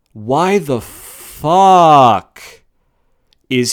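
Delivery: loud, very drawn-out exasperation; slow, incredulous frustrated cry; exasperated outburst. Real, not acted. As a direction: stretch it out and erupt on the last word. Ranting about a bad booking decision.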